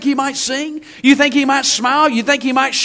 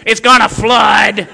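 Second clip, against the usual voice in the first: hammed-up grumpy growl; mock grouchy naysayer; sour complainer; 3 semitones lower, 4 dB louder, slower than usual. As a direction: cut down the cheer with a loud grumble. Mimics sour church complainer.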